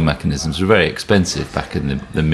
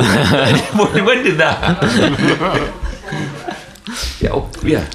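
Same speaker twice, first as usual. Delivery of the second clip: laughing; 10 semitones higher, much slower than usual. Real, not acted. Laughing at game prices.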